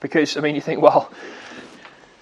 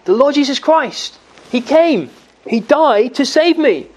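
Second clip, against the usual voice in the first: higher, rising with joyful relief; like a lost man suddenly seeing rescue; hopeful cry of faith; 6 semitones higher than usual. Retold or imitated. Sinner looks up and says.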